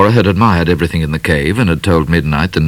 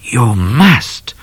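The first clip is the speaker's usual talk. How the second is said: firm, higher-pitched stern order; 5 semitones higher than usual. A narrator's reading. Cat telling Midnight he must, sternly. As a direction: insist sternly. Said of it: "said Cat sternly".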